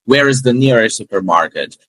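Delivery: Where is the nearest supermarket run together, nearest with no t; smooth native-like flow; connected speech. Shown as a demonstration. Teacher showing his linked English to students. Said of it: "como aqui, ó".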